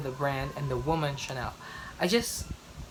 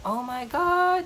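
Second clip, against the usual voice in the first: excited; 10 semitones higher, slower than usual. Retold or imitated. Thrilled reaction in the store.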